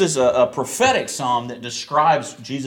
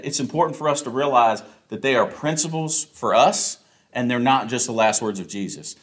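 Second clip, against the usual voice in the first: normal talking voice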